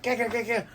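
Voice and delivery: high, quick "ga, ga" bursts; mock fight noises. Buggy sputtering under Luffy's blows, high mock noises. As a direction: sputter like a beaten cartoon clown. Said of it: "Buggy's like".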